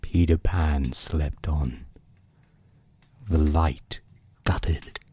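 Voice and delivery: hushed, unhurried bedtime reading; story narration. A narrator's reading. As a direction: read it like a book by the bedside. Reads bedtime chapter aloud.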